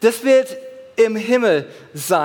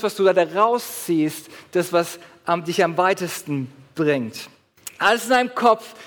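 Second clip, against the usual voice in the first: ordinary talk, nothing marked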